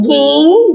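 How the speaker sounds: guem singled out and drawn out; stressed syllable. Shown as a demonstration. Teacher pointing out the tônica of ninguém, guem drawn out. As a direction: lean on guem, holding it long. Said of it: "ela é mais forte".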